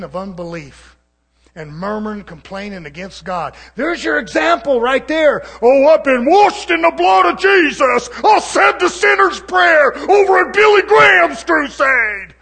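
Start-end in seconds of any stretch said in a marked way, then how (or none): 3.7-5.4 shouted, higher and much louder, preaching hard; fiery preaching shout. Real, not acted. Preacher shouting his example at the congregation. 5.6-12.3 high, loud sneering mock of a pious churchgoer. Preacher mocking a self-satisfied churchgoer, shouted sneer. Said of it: "I'm mocking".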